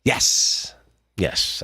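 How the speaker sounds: unvoiced, low spooky yes; ghostly voice. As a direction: answer like a ghost. Answering as ghost.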